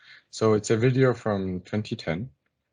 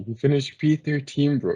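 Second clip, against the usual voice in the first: higher and louder, ribbing a friend; exasperated banter, pitched up; teasing disbelief; 6 semitones higher, 5 dB louder than usual. Real, not acted. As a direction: rib a fan with raised, incredulous voice. Teasing Lewis fan about result.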